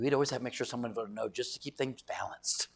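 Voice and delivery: low, breathy whisper; whispered confession. Retold or imitated. Lady explaining her old church always voted no, whispered. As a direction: let them in on a secret, whispered. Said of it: "She told me, she goes".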